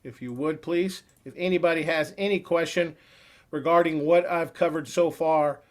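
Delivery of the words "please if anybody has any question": usual speaking voice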